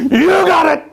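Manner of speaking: sudden loud high shout; jump-scare shout. Acted. Storyteller springing the scare on listeners, loud shout. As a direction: snap it out loud to make them jump.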